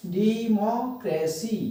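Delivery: Democracy slowly split into syllables. Shown as a demonstration. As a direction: spell out democracy one syllable at a time. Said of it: "अलग-अलग syllables को". (Democracy को अलग-अलग syllable में, धीरे)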